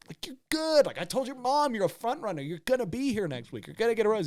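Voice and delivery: high-pitched imitation of her reassuring him; mock insistent reassurance. Retold bachelorette reassuring the anxious suitor.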